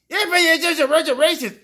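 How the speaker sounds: high, loud sneering mimicry of a heckler; lightly hammed-up mock accuser; mocking opponent. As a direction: throw the accusation back in a jeering put-on voice.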